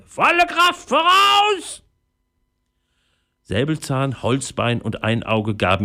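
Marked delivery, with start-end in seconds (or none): 0.1-1.8 very high, stretched parrot screech; Paparazzi, crying out. Acted. Parrot ordering the crew toward the island, screeching. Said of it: "schrie der Papagei".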